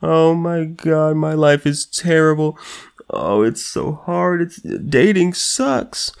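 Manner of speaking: mocking a wailing, self-pitying partner; mock whiny victim. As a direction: ham up a self-pitying moan. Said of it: "You cry, you moan, you scream".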